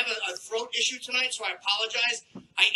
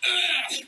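mock a gross throat clear mid-romance; disgusting throat clearing; 6 semitones higher than usual. Lover interrupting an intimate moment, gross throat clearing. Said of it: "clearing your throat is just disgusting".

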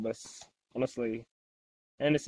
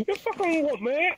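higher mock Australian bloke picking a fight; mock Australian tough guy; 9 semitones higher than usual. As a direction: do a cheeky Australian bogan challenging someone. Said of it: "the Australian Super Saiyan".

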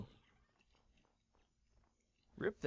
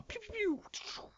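pew sound effects; 6 dB quieter than usual. Pretend. Dive bomb sound effects.

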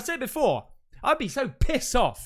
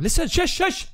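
urgent hushing; 9 semitones higher, 4 dB louder than usual. Real, not acted. Hears something in the background.

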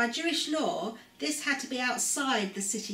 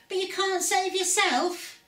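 high sneering taunt, louder; mocking; 9 semitones higher, 3 dB louder than usual. Leaders gloating at Jesus, high mocking taunt. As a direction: throw the taunt up in a jeering voice. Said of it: "they said mockingly".

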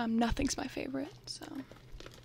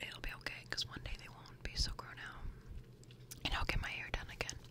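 soft breathy whisper, close to the mic; ASMR whisper; 4 dB quieter than usual. Real, not acted. Vlogger whispering through her bag contents, ASMR style. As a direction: whisper like sharing secrets with a friend.

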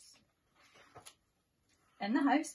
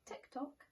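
soft unvoiced tick-tock; whispered clock ticking; 3 semitones higher, 9 dB quieter than usual. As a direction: click it out like a clock in a quiet room. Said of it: "went the living room clock".

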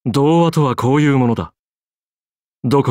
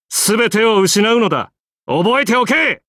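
raised pitch, rising to a forceful order; emphatic warning; 6 semitones higher than usual. Acted. Writer warning off nosy editors, emphatic warning. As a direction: lay down the law, higher and insistent.

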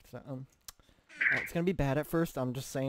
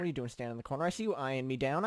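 ordinary talk

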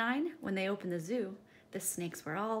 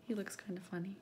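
soft unvoiced aside; whispered aside; 6 dB quieter, faster than usual. Real, not acted. Teacher sharing a joke with the class, whispered. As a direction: lean in and whisper it.

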